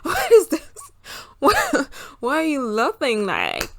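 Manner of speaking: mock-bewildered, loud high-pitched squawking; baffled outburst. Viewer lost watching Love Island UK, exaggerated bafflement. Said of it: "j'étais en mode".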